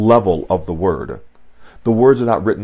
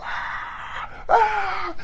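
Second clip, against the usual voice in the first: crowd roar; 7 dB quieter than usual. Pretend. Imitating the crowd going wild.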